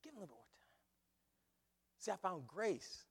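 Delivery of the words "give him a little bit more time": hushed, half-whispered stammer; very soft, hesitant imitation of a peacemaker; quiet pleading. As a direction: intercede softly for the kid. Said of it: "And Stan".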